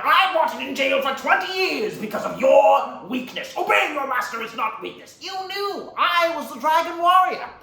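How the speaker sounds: high, loud theatrical outburst; enraged villain. Acted. Villain accuses master after twenty years in jail, furious. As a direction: rage building to a drawn-out shout. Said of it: "he's like".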